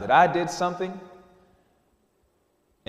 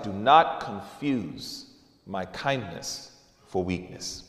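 low, slow, hushed threat; quiet stern warning; 10 semitones lower, 9 dB quieter, slower than usual. Retold or imitated. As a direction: say it slowly and softly, deadly serious. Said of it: "And he was like".

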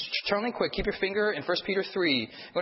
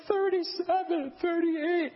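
very high, drawn-out mock wail; hammed-up tearful count, pitched way up; 14 semitones higher, much slower than usual. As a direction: imitate an upset kid counting through tears. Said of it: "has tears in his eyes".

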